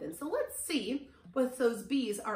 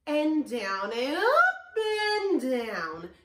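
sliding sing-song rise and fall; voice follows the bee; 6 dB louder, much slower than usual. Shown as a demonstration. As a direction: pitch swoops up on "up", down on "down". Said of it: "we have to go up".

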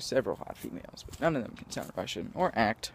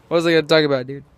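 much louder, frustrated demand; frustrated outburst; 3 semitones higher, 11 dB louder, much faster than usual. Real, not acted. Vlogger losing track of his topic, loud and frustrated. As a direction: blurt it out loudly, exasperated at yourself.

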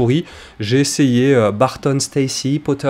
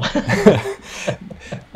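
a quick airy host laugh; short unvoiced chuckle; breathy laugh; 7 semitones higher than usual. Real, not acted. Host amused by guest.

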